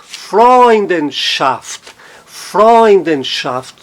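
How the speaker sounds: "Freundenschaft" very slowly, with a strong FREUND; stress on FREUND. Shown as a demonstration. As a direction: stretch Freund in "Freundenschaft", keep schaft weak. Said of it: "no Freund".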